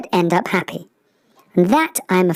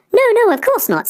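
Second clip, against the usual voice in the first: flustered; 4 dB louder than usual. Acted. Honk flustered, denies insulting swans.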